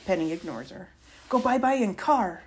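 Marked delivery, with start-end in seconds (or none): 1.3-2.4 put-on high, loud little-kid demand; toddler voice. A narrator's reading. Little girl who loves to ride in the car, high loud toddler voice. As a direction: pipe it up loudly like an eager tot.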